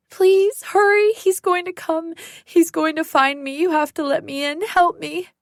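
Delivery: high, loud desperate begging; fleeing woman begs to be let in; panicked pleading. Acted. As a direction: plead frantically, terrified he'll come. Begging stranger to open gate.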